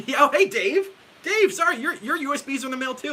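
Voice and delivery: high, loud laughing hello to a friend; excited greeting. Real, not acted. Guitar teacher spots a viewer in the live chat, excited and laughing. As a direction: bursts out happily at spotting him.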